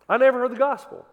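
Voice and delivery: loud, high mock protest; mock shout. Preacher repeating a skeptic's complaint, loud. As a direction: shout the complaint back at them.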